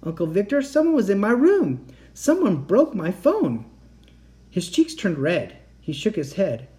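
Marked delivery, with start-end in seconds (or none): 0.0-3.7 high, loud, distraught plea; upset cry. A narrator's reading. Girl to her uncle at dinner, crying out upset. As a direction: cry out that someone broke in. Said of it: "I cried".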